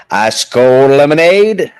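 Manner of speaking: high, loud, slow hawker cry; lemonade vendor call. Shown as a demonstration. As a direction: call it out to the stands. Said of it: "Trent, what about lemonade?".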